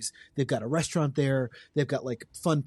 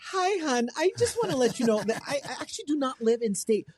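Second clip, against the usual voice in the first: June; 10 semitones higher than usual. Acted. Calling the orchard about shipping apples, high Midwestern mom. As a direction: play an old-school Midwestern mom, pitched way up. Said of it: "I created this character on the radio".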